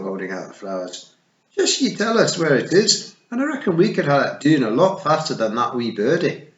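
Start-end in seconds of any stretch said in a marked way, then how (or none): 1.5-6.5 higher, louder eager Scottish; helpful and keen; Scottish Feegle. A narrator's reading. Daft Willie offering to flatten the mountain, eager Scots.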